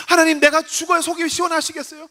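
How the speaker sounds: high-pitched reproach to God; desperate complaining prayer; resentful outcry. Acted. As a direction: cry out in acted grievance. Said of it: "원망하고 절규하고".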